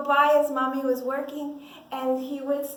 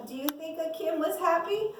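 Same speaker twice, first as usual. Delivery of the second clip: usual speaking voice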